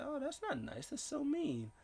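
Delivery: high, soft hurt little voice; hammed-up wounded whine, small and high; mock sad. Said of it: "gets all sad".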